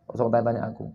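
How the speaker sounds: low, subdued, acted gloom; gloomy, troubled reply. Troubled man turning away someone's greeting, gloomy and subdued. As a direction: brush them off softly, sinking away at the end. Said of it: "terpengaruhi dengan kondisi itu".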